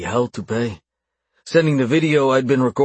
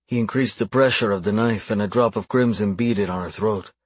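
ordinary delivery, nothing marked

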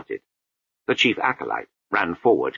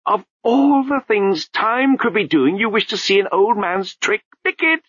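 abbot's voice; 13 semitones higher, 5 dB louder than usual. A narrator's reading. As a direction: stretch it out high and amused. Abbot questions Lobsang.

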